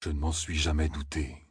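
low hushed murmur. A narrator's reading. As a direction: keep it soft and low. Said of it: "murmura Loyal".

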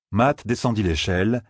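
ordinary talk, nothing marked

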